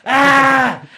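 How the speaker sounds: very loud, high scream; full-force high yell of agony; scream of pain. Retold or imitated. Injured man at his open front door, screaming.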